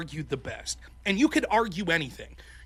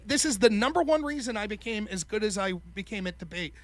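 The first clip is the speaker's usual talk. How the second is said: ordinary talk, nothing marked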